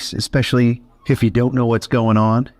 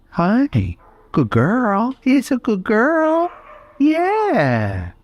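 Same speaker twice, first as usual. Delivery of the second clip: high, drawn-out gentle praise; high pet voice; 12 semitones higher, much slower than usual. Real, not acted. Reaching to touch the shy shepherd, high cooing praise.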